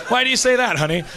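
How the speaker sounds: high, sweetly inquiring partner voice; puzzled spouse. Acted. Spouse questions partner driving home, high and puzzled.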